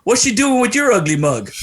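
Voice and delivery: imitating a star's punchy, high-pitched jab; Charles Barkley. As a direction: blurt it out as a teasing put-down. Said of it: "He looks at me and goes".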